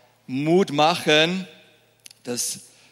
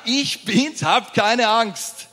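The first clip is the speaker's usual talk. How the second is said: higher, louder and slow, an emphatic Jesus; Jesus retold; 7 semitones higher, 3 dB louder, slower than usual. Preacher replays Jesus' words to the church, higher, louder and slow. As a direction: announce it big and clear, as Jesus. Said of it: "Er hat doch gerade gesagt".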